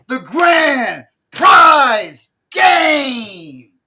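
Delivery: booming, very drawn-out game announcement; show announcer. Retold or imitated. Recalling the clown show's big game for friends, show announcer. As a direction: shout each word big and slow like an announcer.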